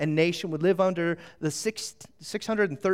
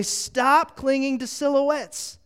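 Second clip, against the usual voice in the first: preaching plea; 7 semitones higher than usual. Retold or imitated. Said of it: "the writer is trying to say".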